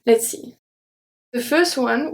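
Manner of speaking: ordinary delivery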